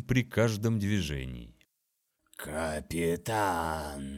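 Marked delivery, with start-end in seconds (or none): 2.3-4.1 slow, low, creaking insect drone; creaking buzzing voice. Acted. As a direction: drag it out like a buzzing swarm. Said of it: "проскрипела и зажужжала".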